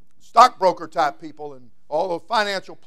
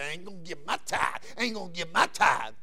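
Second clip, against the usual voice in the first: stingy refuser; 7 dB quieter than usual. Retold or imitated. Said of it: "some people say".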